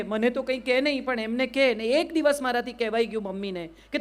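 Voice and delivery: ordinary delivery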